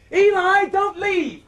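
loud call after him. Acted. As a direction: shout after someone leaving. Calling after the dummy.

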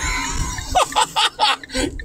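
big high burst of laughter. Real, not acted. Mocks Christians, whole Christendom laughs.

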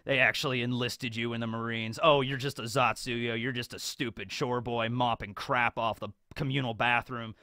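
low mocking prisoner voice; Morgan sneering. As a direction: talk down to the boy from a cell. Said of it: "he's just like".

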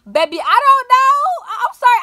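flustered apology. Retold or imitated.

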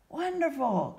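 high, falling exclamation of joy; delighted cry. A narrator's reading. Carpenter hears of long life, delighted cry. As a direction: cry out happily at the prophecy. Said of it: "cried the carpenter".

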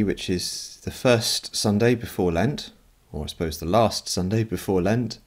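ordinary delivery

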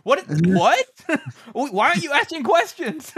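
incredulous rant. Retold or imitated. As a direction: work yourself up in disbelief. Glock voiced ranting in book recap.

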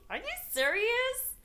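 high, slow and amazed; disbelief. Retold or imitated. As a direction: stretch it out in pure disbelief. Hearing the car is hers.

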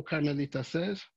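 usual speaking voice

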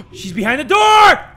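high, loud, drawn-out startled yelling; real jump-scare shouting; panicked shout. Real, not acted. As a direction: holler in alarm, stretching the last word. Jump scare, spots woman behind door.

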